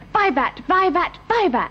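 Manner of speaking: retold school cheer. Granddaughter retelling the Westminster scholars' cheer, mild mock shout. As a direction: echo the boys' cry of vivat, lightly. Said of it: "the scholars of Westminster School shouted".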